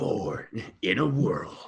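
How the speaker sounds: slow, soft trailer voice with a laugh; laughing through an epic trailer line; movie trailer voice. Acted. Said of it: "best Disney trailer voice".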